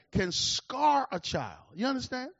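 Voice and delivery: ordinary delivery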